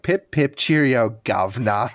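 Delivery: hammy, stock British accent; badly faked British accent. Shown as a demonstration. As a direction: do a cartoon Brit, cheerily corny British accent. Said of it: "my best British accent is like".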